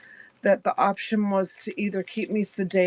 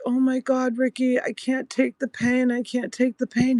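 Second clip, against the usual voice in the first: acted-out distress, voice held high; retold pained pleading; 5 semitones higher than usual. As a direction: re-enact begging for relief from pain. Retold plea to husband in agony.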